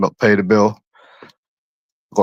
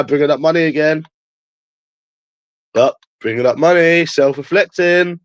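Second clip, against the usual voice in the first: jeering in a raised pitch; mocking taunt; 5 semitones higher than usual. Streamer back to mocking talk of money, high taunting. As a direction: tease them, drawing out the last words.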